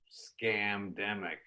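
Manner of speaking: slowly drawn-out bait word; slow provoking drawl. Retold or imitated. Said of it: "I go".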